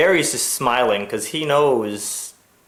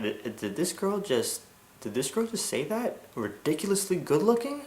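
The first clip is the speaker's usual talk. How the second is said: hushed disbelief; 6 semitones higher, 10 dB quieter than usual. Retold or imitated. He is stunned by compliment.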